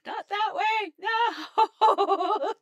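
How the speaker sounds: high, very loud yelp breaking into laughter; alarmed shout. Real, not acted. Player yelling as the shortcut goes wrong, alarmed shout. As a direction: shriek it as things suddenly go wrong.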